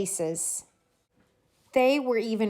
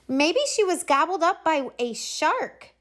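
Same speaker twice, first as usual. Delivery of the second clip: put-on kid guessing; 8 semitones higher than usual. A narrator's reading. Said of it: "said one of the kids".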